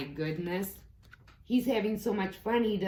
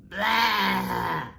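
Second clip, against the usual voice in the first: grumpy blah; 2 semitones higher, 6 dB louder than usual. Acted. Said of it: "said Toad".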